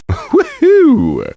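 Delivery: high laughing woohoo; gleeful high cheer; excited whoop. Acted. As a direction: whoop with glee at the win. Cheering after a win.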